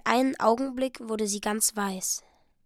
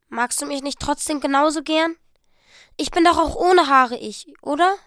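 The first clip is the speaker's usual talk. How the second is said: pleading; 4 semitones higher, 7 dB louder than usual. Acted. Della pleads with Jim.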